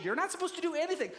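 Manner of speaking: high, fast scolding comeback; angry retort. Acted. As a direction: cut back in heatedly. Acted shouting match, trainer and jockey.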